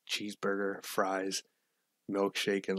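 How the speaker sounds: ordinary talk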